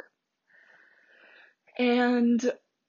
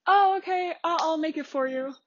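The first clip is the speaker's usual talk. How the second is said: high, bright helpful voice; high sweet barista girl; 6 semitones higher than usual. Retold or imitated. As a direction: chirp it like a friendly barista. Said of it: "some other girl was like".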